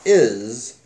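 normal talking voice

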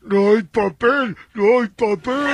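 loud, drawn-out call through a cardboard tube; megaphone-style shouting. Retold or imitated. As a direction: holler it slowly into a tube, acted. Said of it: "y grita".

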